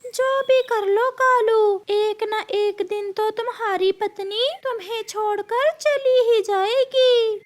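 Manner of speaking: high, piercing scolding bird; high-pitched cartoon nag; taunting bird character.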